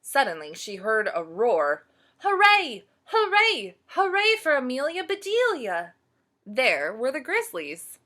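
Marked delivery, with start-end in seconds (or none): 2.2-5.9 cheering crowd. Acted.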